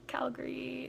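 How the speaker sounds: tiny, high, hushed answer; starstruck whisper. Retold or imitated. Telling Cone where she is from, starstruck whisper. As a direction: squeak it out under your breath.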